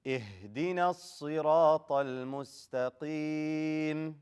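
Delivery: slow chanted verse with long held endings; Quran recitation. Shown as a demonstration. Teacher recites for class to repeat, slow chanted recitation. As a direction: recite measured, stretching the final vowel.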